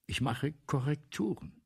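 ordinary delivery

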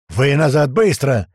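loud, urgent command; yelled order. Acted. Tuman orders fighters off the platform, yelling. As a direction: drive them back with your voice. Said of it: "начал орать Туман".

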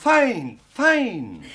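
delighted. Acted. Dressmaker admiring the girl in her new dress, delighted. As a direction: gush over how fine it looks.

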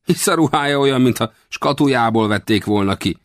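high-pitched scoffing with a pout; contemptuous. A narrator's reading. Old westerner mocking the stranger's brand-new clothes, high and scornful. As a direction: sneer at him in a high voice. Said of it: "mondta megvető ajk bigyeztéssel".

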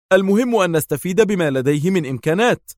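a lighter second man's voice; character voice. A narrator's reading. Gula's voice, different character.

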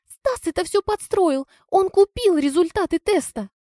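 high-pitched, agitated female character voice; shrill-pitched protest; frantic accusation. A narrator's reading. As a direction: plead and accuse in a high squeal. Лизка frantically accuses Стас.

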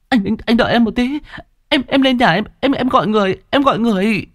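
put-on high, fast stammering girl in panic; panicked stammer. Kiều tells her sick brother she'll fetch help, high panicked stammer. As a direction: stumble over the words in fear.

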